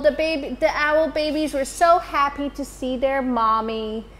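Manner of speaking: ordinary delivery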